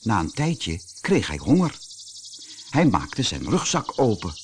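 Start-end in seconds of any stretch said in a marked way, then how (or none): none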